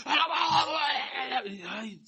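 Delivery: high, laughing "Alabado"; laughing mock gushing; mock effusive praise. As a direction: overdo the showy worshipper. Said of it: "muy efusivo".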